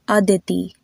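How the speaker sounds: Indian-style "Aditi", tongue touching the back of the teeth; Indian pronunciation. Shown as a demonstration. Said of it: "lighter with the Indian pronunciation".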